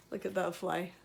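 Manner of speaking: ordinary delivery, nothing marked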